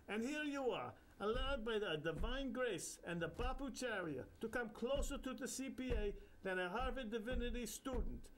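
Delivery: put-on high, sing-song Indian accent; jovial Indian guru. Bapucharya teasing the narrator, Indian accent. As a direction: joke like a cheeky holy man.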